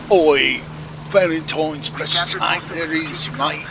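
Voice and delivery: a hammed-up Cockney "Oi, mate", loud on the Oi; Cockney accent, brash and teasing; mock British bloke. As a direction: imitate a cheeky British player mocking you. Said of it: "I'm sure there's people out there going".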